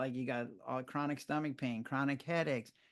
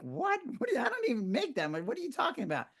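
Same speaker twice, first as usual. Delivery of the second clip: retold disbelief, pitch jumping higher; shocked what; 9 semitones higher than usual. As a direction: blurt it out in stunned disbelief. Stunned reaction to IRS letter.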